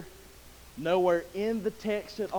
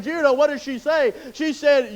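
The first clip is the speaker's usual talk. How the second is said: ordinary talk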